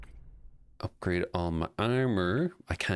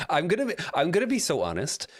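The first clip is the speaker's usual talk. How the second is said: excited; 10 semitones higher, faster than usual. Real, not acted. Excited confession to chat.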